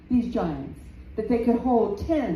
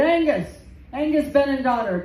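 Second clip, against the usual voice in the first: loud, raised call to a far-off giant; calling across the sea; 5 semitones higher, 4 dB louder than usual. Acted. Finn calls loudly across the sea to the Scottish giant. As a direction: bellow his rival's name across the water. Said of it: "he called across the waters".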